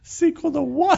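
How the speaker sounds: high incredulous question; surprised. Real, not acted. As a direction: squeak it out in disbelief. Mock confusion at sequel joke.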